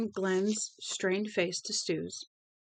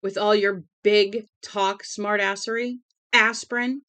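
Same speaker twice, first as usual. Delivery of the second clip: furious shrieking; 3 semitones higher, 10 dB louder than usual. A narrator's reading. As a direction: tear into him for his big talk. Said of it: "This time she shrieked it".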